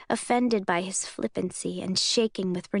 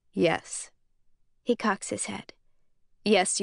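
plain reading voice

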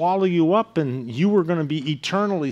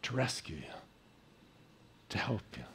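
soft appeal; 6 semitones lower, 17 dB quieter, faster than usual. Real, not acted.

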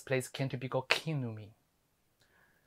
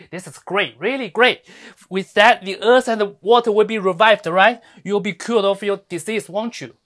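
mock praise, pushed louder and higher; elogio burlón; 3 semitones higher, 9 dB louder than usual. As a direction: cheer their good luck with loud scorn. Mock praise in a scolding speech.

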